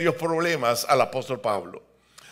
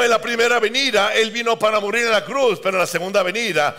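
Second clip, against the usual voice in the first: usual speaking voice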